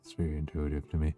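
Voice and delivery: soft, hushed ASMR murmur. Acted. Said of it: "I'm literally going into it without meaning to".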